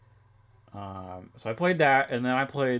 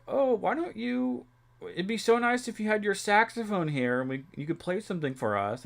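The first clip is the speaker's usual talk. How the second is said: pleading relatives; 9 semitones higher than usual. Retold or imitated. Family asking for a saxophone tune over dinner, high mock coaxing. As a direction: imitate wheedling family in a high voice. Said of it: "they were like".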